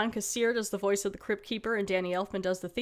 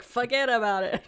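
put-on New York accent; 3 dB louder than usual. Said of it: "he was like".

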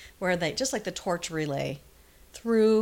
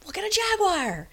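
excited townsfolk; 11 semitones higher, 5 dB louder than usual. Retold or imitated. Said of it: "it's gonna be".

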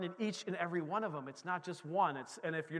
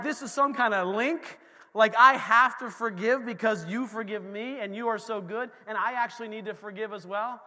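normal talking voice

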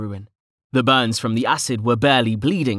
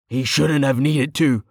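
curt; 3 semitones higher than usual. A narrator's reading. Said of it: "Tilson said curtly".

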